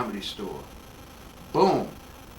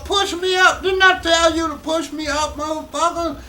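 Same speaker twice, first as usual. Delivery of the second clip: high-pitched, loud, raspy-edged mock yell; Richard Pryor yelling; 12 semitones higher, 6 dB louder than usual. Comedian imitating his idol yelling from the back, loud and high. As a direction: bellow it from the back of the room, half-laughing at the crude demand. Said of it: "He go".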